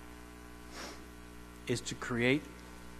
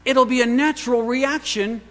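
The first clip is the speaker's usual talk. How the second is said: higher, louder, earnest Jesus voice; preacher voicing Jesus, higher and louder; 8 semitones higher, 6 dB louder than usual. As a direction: speak as Jesus, pleading and bright. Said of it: "He says".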